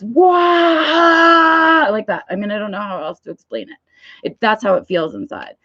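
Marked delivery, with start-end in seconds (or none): 0.0-1.9 high wailing "wah" of overload; panicked cry sound. Retold or imitated. Brain panicking before a surprise work meeting, high wail. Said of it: "my brain goes".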